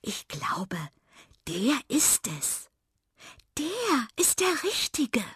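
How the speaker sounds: breathy, halting, rising high in wonder; smitten whisper. Acted. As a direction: breathe it out, lovestruck. Miranda realises she is in love. (verliebt gehaucht)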